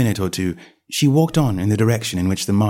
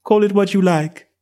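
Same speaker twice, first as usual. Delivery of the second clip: put-on young girl voice; 9 semitones higher, 3 dB louder than usual. A narrator's reading. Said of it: "said the cat".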